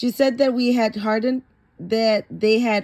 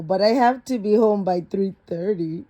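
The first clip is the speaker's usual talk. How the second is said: plain reading voice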